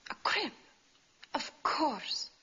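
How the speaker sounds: sudden realisation. Acted. Instructor solves her uncle's clue, sudden realisation. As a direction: let the answer burst on you, pitch jumping up.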